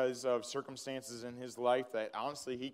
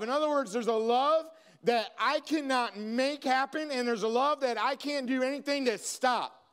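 raised, fervent preaching voice, higher and louder; 9 semitones higher, 5 dB louder than usual. Acted. Pastor preaching forgiveness to the congregation, fervent and raised. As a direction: preach it out, building with passion.

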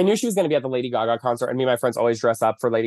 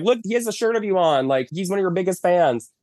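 paparazzi pitching; 7 semitones higher than usual. Retold or imitated. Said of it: "they're like".

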